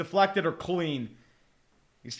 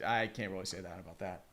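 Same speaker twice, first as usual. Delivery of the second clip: normal talking voice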